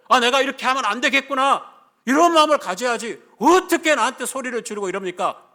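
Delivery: high, very loud scolding at full force; furious rebuke. Acted. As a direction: let the pent-up indignation out in a shouted tirade. 참았던 호통을 전력으로 연기함. (격분해서 큰 소리로 호통치듯)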